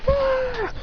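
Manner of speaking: high, drawn-out mock scream. Bullies sent flying by the kick, high mock scream. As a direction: scream high without real volume. Knocked-back crowd cries out.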